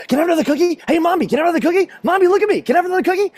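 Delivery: put-on high, pestering kid voice; rapid, insistent begging like a little boy; annoying child. Son begs Mommy for another cookie, pestering. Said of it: "whenever he asks an annoying question".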